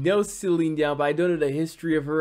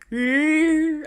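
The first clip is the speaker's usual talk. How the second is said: high held ear sound, louder; imitating her high note; 7 dB louder than usual. Shown as a demonstration. Reactor showing viewers that certain tone, high and loud. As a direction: copy the singer's ringing note. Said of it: "I can just demonstrate".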